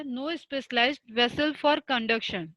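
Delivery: very slow, every word spaced out for students to write; dictating a definition. Shown as a demonstration. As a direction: spell it out slowly for notes. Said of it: "definition of fungi". (परिभाषा धीरे-धीरे लिखवाना)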